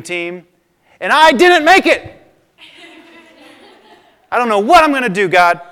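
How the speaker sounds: put-on stamp and yell like an angry girl; bossy little princess. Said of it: "she goes".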